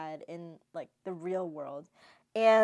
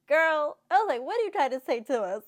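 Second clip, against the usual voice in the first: higher and louder, incredulous; exasperated callout; 7 semitones higher, 8 dB louder than usual. Retold or imitated. Viewer calling out the heroine, high and loud. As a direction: call out the character in disbelief.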